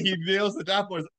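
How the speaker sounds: usual speaking voice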